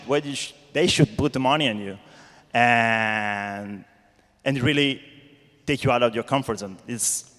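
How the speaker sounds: ordinary talk, nothing marked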